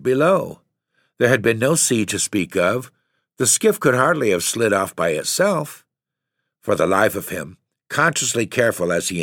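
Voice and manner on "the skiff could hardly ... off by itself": plain reading voice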